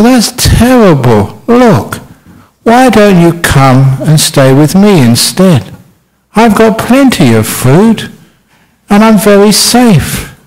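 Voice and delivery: jolly fat spider. Acted. Fat spider invites the sad spider home, high and slow. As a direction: coax gently from high up, slowly. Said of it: "he said".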